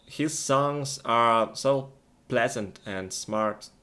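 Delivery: usual speaking voice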